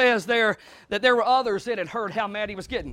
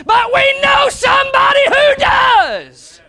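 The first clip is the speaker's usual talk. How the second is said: slow, much higher, loud cry; preaching climax; 12 semitones higher, 10 dB louder, much slower than usual. Acted. Preacher telling the broken where healing is, slow loud cry. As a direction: ring it out to the back pew.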